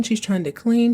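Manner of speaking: ordinary talk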